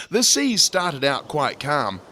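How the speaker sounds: usual speaking voice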